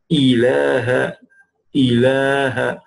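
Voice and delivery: "ilaha" slow with open throat "ha"; drawn "ilaha", clear "ha"; stretched ilaha. Shown as a demonstration. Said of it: "the ha".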